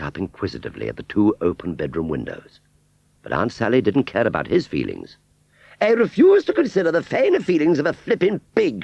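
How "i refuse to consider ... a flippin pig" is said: high, louder haughty outburst; angry outburst. A narrator's reading. Aunt Sally indignant about the pig, high haughty outburst. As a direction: snap at him, refusing the pig. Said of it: "she screamed".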